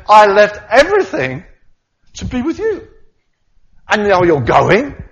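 high, loud pleading cry; anguished protest. Pretend. Peter protesting to Jesus, loud high anguish. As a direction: throw the words out in despair.

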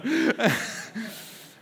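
loud open laugh; hearty laugh. Real, not acted. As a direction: laugh at your own story. Preacher laughs.

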